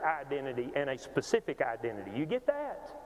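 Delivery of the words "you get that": ordinary talk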